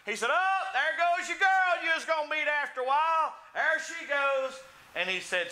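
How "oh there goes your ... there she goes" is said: high, loud mocking holler. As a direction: imitate a heckler yelling from the back row. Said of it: "they're hollering out loud".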